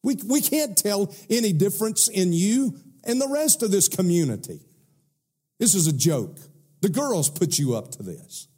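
high, laughing scornful voice; mocking sons-in-law. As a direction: sneer and laugh at the old man.

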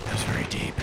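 Batman voice. Acted. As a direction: growl it low and gravelly like Batman. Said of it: "we still sound like Batman".

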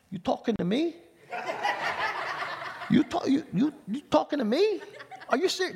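high-pitched incredulous challenge; movie tough guy. Acted. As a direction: play a comic movie mobster squaring up. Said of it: "watching too many Godfather movies".

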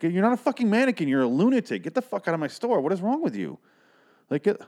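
high-pitched mock rant; angry shouting manager. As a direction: manager berating the woman, high angry yell. Manager yelling at fake mannequin.

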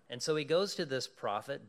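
ordinary delivery

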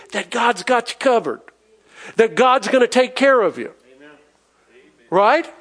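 ordinary delivery

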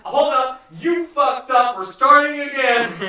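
loud, high, slowing at the end; bandleader shout. Shown as a demonstration. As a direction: bark the band to a stop, then drag it out. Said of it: "or better like this".

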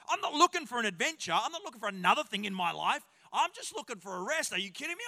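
reluctant complainer. Retold or imitated. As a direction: push back like a tired young barista. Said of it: "other people that go".

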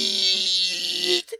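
high, loud sustained screech; imitated tyre squeal. Street racers whipping donuts, high imitated tyre squeal. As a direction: squeal like tyres doing donuts, held long. Imitating tyres heard at intersection.

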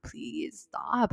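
whispered, pleading exasperation; inner plea. Retold or imitated. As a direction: beg silently, barely letting it out. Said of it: "in my head, I'm like".

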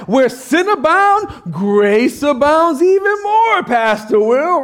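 high, emphatic, drawn-out verse quoting; objecting churchgoer. Acted. Churchgoer answering the pastor with scripture, emphatic mock protest. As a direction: proudly declaim the verse as a rebuttal. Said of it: "you're probably sitting there saying".

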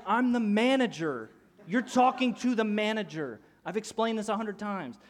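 imitating a fed-up manager; exasperated retort. Manager arguing back at irate dad.

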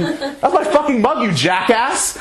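high shrill-pitched scolding, half laughing; mock yell. Jen yelling from the kitchen over her broken mug, high and laughing. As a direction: toss it off like an outraged friend. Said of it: "she yells at me from the kitchen".

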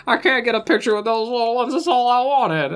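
high, distraught comic-actor impression; hammed-up celebrity lament; Don Knotts. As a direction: whine it in mock heartbreak, slowing down. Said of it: "Don Knotts is still so distraught".

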